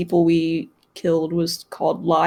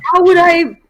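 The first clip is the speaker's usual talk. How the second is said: mock indignant; 10 semitones higher, 6 dB louder than usual. Player's mock offended protest.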